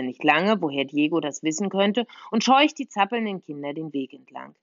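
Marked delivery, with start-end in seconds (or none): none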